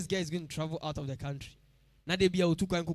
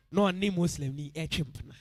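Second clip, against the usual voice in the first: ordinary talk, nothing marked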